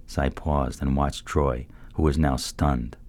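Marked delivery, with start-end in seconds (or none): none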